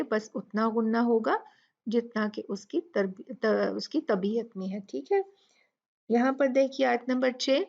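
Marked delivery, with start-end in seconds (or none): none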